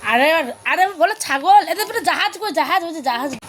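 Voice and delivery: put-on high, lilting picture-book voice for a toddler; sing-song reading to a child. Mother to toddler over a picture book, high sing-song coaxing. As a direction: coax the little one to name pictures, sing-song.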